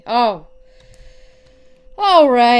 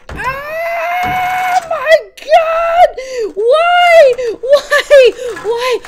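much higher, loud, half laughing half crying; panicked laughing scream; 11 semitones higher, 4 dB louder, much slower than usual. Real, not acted. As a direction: shriek in real panic, laughing from nerves. Animatronic attacking in horror game.

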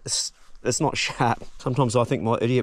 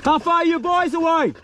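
high carrying call into the gully; 3 dB louder than usual. Real, not acted. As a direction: shout to mates far away. Calling to mates down gully.

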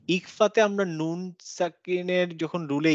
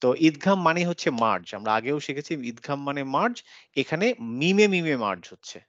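normal talking voice

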